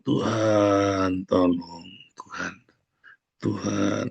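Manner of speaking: feeble, pleading moan to God; mock whimpering prayer. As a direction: imitate a tired believer groaning. Mocks whimpering prayer again. (rintihan lemah yang dibuat-buat)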